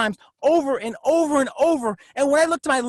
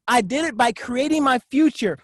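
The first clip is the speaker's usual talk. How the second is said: usual speaking voice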